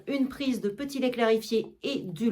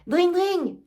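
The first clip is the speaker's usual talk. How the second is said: higher ringing "dring, dring" like a hand bell; bell imitation; 7 semitones higher, 4 dB louder than usual. Argan ringing for his servants, mimicking a bell. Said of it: "Il sonne une sonnette".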